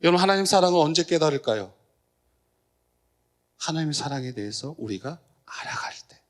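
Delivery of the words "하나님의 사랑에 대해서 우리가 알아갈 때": whisper. Real, not acted. Intimate point to congregation.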